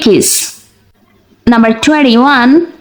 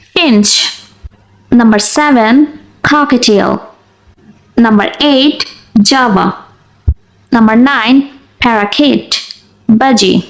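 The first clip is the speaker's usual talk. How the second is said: ordinary talk, nothing marked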